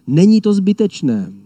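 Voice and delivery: high, loud cry; the good lion calling out to believers; emphatic declaration. Retold or imitated. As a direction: proclaim it boldly. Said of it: "on nám říká".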